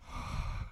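long unvoiced sigh of defeat; heavy sigh. Caught employee sighing before confessing, soft and heavy. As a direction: let out a caught, guilty sigh.

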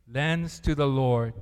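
slow, loud reading aloud in unison; slow group reading. Shown as a demonstration. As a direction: lead the congregation word by word. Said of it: "Read it with me".

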